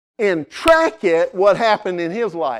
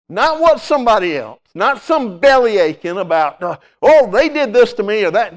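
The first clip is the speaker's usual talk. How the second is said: rising, loud preacher's challenge; preaching at full force; 3 semitones higher, 4 dB louder than usual. Acted. Pastor challenging the congregation, preaching hard. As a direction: drive the question home to the pews.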